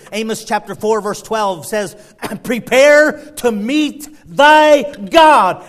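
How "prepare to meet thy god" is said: slow, loud, drawn-out proclamation; booming warning. Acted. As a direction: thunder it out slowly like a prophet's warning. Preacher booms scripture warning.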